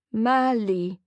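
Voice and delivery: strange, wrong-sounding call voice; off robotic tone. Acted. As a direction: say his name like something's broken. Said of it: "Her tone is off".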